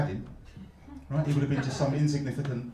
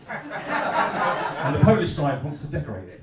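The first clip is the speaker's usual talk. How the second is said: slow punchline; 5 dB louder, much slower than usual. Acted. Punchline slowed down.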